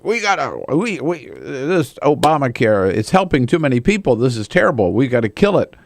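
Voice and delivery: high, hammed-up zealot voice; sneering caricature of angry conservatives; mock Tea Party outrage. Mocking Tea Partiers' outrage.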